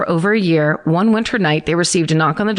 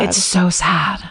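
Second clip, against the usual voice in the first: whispered; slower than usual. Real, not acted. Moved by sad story.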